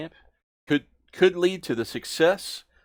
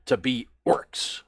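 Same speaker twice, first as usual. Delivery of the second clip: orc drill boss; 3 semitones higher, 9 dB louder than usual. Acted. Orc boss telling the recruit to start at the bottom, loud. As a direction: lay down the rules for recruits.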